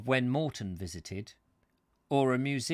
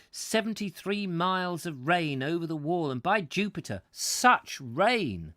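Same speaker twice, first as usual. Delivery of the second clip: raised, theatrical read-aloud addressed to Marcus; story reading; 2 semitones higher, 5 dB louder than usual. A narrator's reading. Narrator commiserates with Marcus the centurion over the rain, theatrical reading. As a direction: read it out with rhetorical emphasis.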